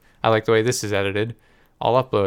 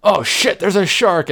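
high, loud panicked yell; startled shout; 10 semitones higher, 6 dB louder, slower than usual. Retold or imitated. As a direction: yelp it like you spotted a shark. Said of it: "I'm like".

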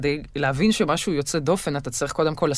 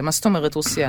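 normal talking voice

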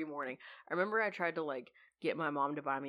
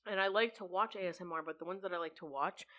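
whispering. Real, not acted. Whispering about ASMR.

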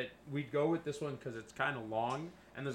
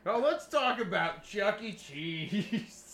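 laughing; 7 semitones higher, 6 dB louder, much slower than usual. Real, not acted. Mock topic switch, laughing.

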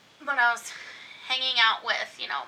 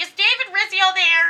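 loud high call; 9 semitones higher, 15 dB louder than usual. Retold or imitated. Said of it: "and we're like".

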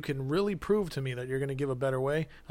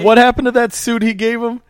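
much higher and louder jab; heated challenge; 8 semitones higher, 12 dB louder than usual. Real, not acted. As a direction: hammer the gotcha question. Heated mock debate.

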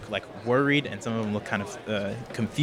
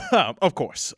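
laughing reply; 8 semitones higher, 4 dB louder than usual. Acted. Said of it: "steps forward and goes".

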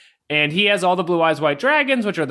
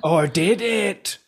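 slow, eerie teasing question; spooky ghost voice; 2 semitones higher, much slower than usual. Pretend. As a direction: draw it out like a ghost story.

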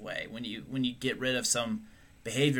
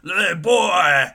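taunting shout; 9 semitones higher, 10 dB louder, slower than usual. Pretend. Crowd taunting Luke.

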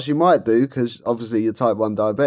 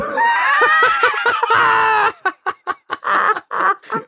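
a long, very high fit of laughter; shrieking laugh. Real, not acted. Host cracking up at his own story, shrieking laugh. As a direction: crack up helplessly.

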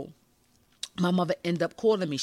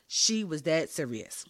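ordinary talk

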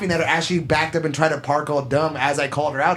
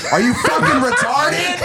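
high-pitched outburst breaking into laughter; retold scream; 9 semitones higher, 3 dB louder than usual. Driver confronting woman parked in two spots, retold scream. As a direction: burst out incredulous, high, laughing. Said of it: "And I just screamed that".

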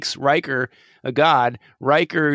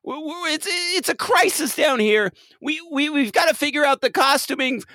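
high, urgent, rising to a shout; panicked call about costumes; frantic caller; 8 semitones higher than usual. Pretend.

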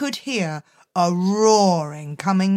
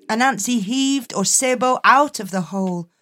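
ordinary delivery, nothing marked

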